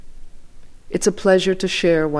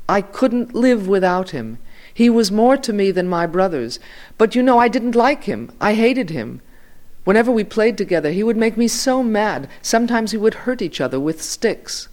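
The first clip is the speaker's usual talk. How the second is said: higher, louder, agitated recollection; impassioned old journalist; 7 semitones higher, 4 dB louder than usual. A narrator's reading. Elderly Yiddish journalist recalling his boyhood friend, impassioned. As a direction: insist heatedly, pitch riding high.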